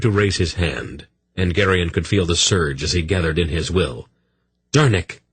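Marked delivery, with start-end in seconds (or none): 4.7-5.2 higher, louder cry of alarm. A narrator's reading. Polgara crying out to stop the smith, loud. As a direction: stop him with his name. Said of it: "Polgara cried".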